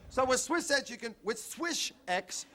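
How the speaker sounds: high, laughing sales pitch; ad read. Acted. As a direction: pitch the spray while cracking up.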